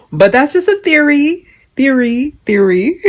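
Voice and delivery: high-pitched, echoing disclaimer; playful sing-song. Pretend. Vlogger covering herself after gossip, playful high sing-song. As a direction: repeat it in a high voice, stepping down.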